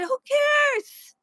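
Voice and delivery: high, shouted who cares, in a put-on voice; mock shout. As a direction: toss it off in a squeaky mock yell. Quoted retort, very high, far.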